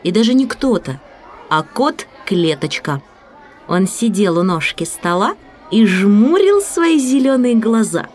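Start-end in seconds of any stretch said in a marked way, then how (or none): none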